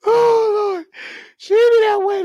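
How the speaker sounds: high churchy exclamation; imitating grandma calling on the Lord; high mock grandma.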